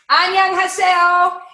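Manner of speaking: high, loud, drawn-out Annyeonghaseyo; loud cheerful greeting. Retold or imitated. Greeting her Korean dry cleaner, a loud bright Annyeonghaseyo. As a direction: call out Annyeonghaseyo brightly and slowly. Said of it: "I say".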